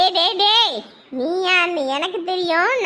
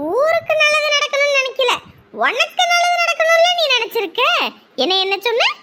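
fierce tough-woman threat; 9 semitones higher than usual. Acted. Wife threatening her husband, calling herself Dindigul Rita, high and fierce. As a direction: threaten him like a local tough.

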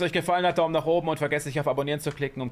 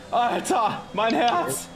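heart racing; 8 semitones higher, much slower than usual. Real, not acted. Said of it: "Hundertfünfziger Puls".

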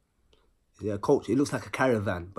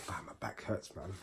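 real whispered complaint, much softer; whispered aside; 5 semitones lower, 15 dB quieter than usual. Real, not acted. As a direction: drop to a whisper to grumble about your back. Aside about his aching back.

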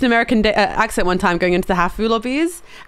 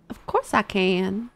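slow put-on American drawl; American accent; 7 dB quieter, slower than usual. Shown as a demonstration. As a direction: show off an exaggerated American accent. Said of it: "can you do an American accent".

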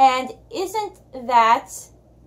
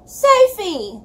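higher, louder happy call across a crowd; shouting; 7 semitones higher, 5 dB louder than usual. Acted. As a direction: call her sister's name over the noise. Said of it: "she shouted".

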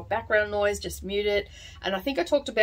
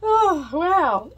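surprised; 11 semitones higher, 7 dB louder, slower than usual. Real, not acted. Loud drag racing in street.

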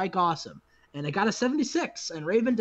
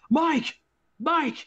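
higher, acted call after someone; 8 semitones higher, 4 dB louder than usual. Raven calling Mike back from the ramp, calling out. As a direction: call to a man walking away.

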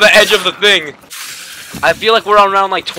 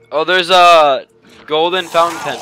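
ordinary talk

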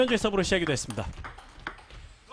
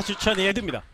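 ordinary delivery

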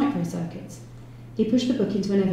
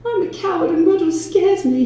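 high, loud mock-girlish squeal; squeaky girlish voice; 11 semitones higher, 6 dB louder than usual. A narrator's reading. Detective joking about fearing his boss, squeaky and girlish. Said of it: "putting on a squeaky girlish voice".

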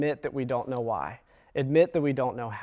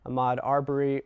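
ordinary talk

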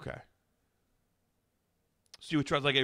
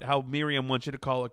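usual speaking voice

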